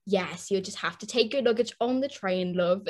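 imitating a UK ticket seller's accent; mock UK accent. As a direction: put on a friendly UK accent. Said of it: "I repeated the same sentence in front of her face with that accent".

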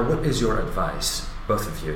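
ordinary delivery

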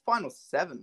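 ordinary talk, nothing marked

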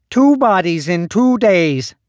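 high, slow repellent whine; whiny voice. A narrator's reading. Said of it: "a repellent whine".

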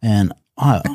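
ordinary talk

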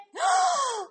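sharp high gasp of shock. Acted. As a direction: gasp as if scandalized. Shocked family reacts.

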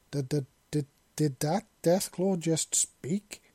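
slow, stammering disbelief. Acted. Shocked man stammers after hearing a Deathclaw speak, slow. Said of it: "the man stammered".

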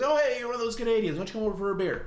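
high, loud, hearty mock American; exaggerated American accent. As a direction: ham up an American calling someone over. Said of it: "this does sound like an American".

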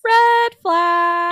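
high, loud alarm call; shouted red flag; loud warning. Real, not acted. Listener reacts to story.

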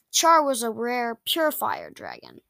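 ordinary delivery, nothing marked

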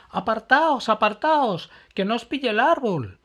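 high, loud urgent shout; shouting warning. Acted. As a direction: yell at friends to get clear. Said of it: "gritó Emma".

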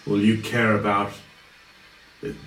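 scornful villain. Acted. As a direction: belittle the boy wizard, then go cold. Villain taunts the party.

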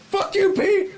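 high, loud jokey yell; mock yell. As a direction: yell it at Pete for a laugh. Mock yelling at co-host Pete.

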